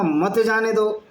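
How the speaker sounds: मत जाने दो as one slow phrase; मत joined to जाने. Shown as a demonstration. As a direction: keep मत attached to जाने दो after the break. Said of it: "आगे कॉमा".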